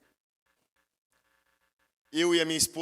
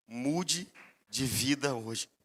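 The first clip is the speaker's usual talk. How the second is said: earnest appeal; 4 semitones lower, 4 dB quieter, much slower than usual. Real, not acted. Sermon closing appeal.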